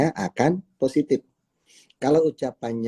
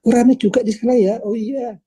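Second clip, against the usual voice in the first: much higher, fast and loud friendly remark; chatty leader; 12 semitones higher, 4 dB louder than usual. Acted. Leader chatting with the member at the school meeting, bright high chatter. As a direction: keep the chat bright and lively.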